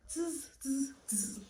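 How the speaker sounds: buzzing monkey chatter, fading. A narrator's reading. Monkeys copy the stomping peddler, buzzing. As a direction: chatter back like cheeky monkeys.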